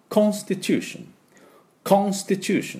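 British English, rounded "KON" in Constitution; high British model pronunciation; British accent. Shown as a demonstration. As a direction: say Constitution as a Briton, high-pitched. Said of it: "イギリス人が発音すると".

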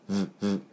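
two soft short "vvv" buzzes; lip-on-teeth buzz, twice. Shown as a demonstration. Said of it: "携帯バイブ". (携帯バイブのように「ヴ、ヴ」)

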